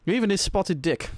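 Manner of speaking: ordinary talk, nothing marked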